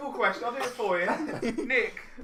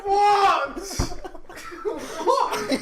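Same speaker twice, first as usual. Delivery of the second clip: incredulous shouts, high and loud; totally lost and shouting it; loud bafflement; 5 semitones higher, 6 dB louder, much slower than usual. Real, not acted. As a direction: fire back "what" in loud disbelief. Co-host baffled by the rambling riddle.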